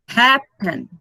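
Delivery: Happen split, first syllable strong; "Happen" in two beats, weight on the first; stress on first syllable. Shown as a demonstration. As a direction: punch the start of "Happen", let the end fall. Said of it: "A sílaba mais forte é o".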